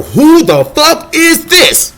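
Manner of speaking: high, slow, drawn-out amazed question; stunned disbelief. Retold or imitated. First watching the rapper's video, slow high disbelief. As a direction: stretch it out, floored.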